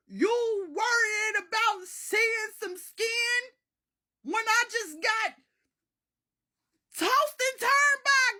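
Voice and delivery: hammed-up shrieking protest; outraged disbelief. Narrator haunted by a ghost scolding granny, outraged shrieking.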